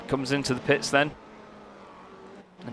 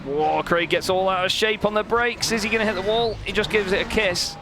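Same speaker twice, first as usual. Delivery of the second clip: higher, startled commentary as the car slides; excited alarm; 7 semitones higher than usual. Real, not acted. Commentator watching Craig nearly hit the wall, excited. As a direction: gasp out the near miss with the wall.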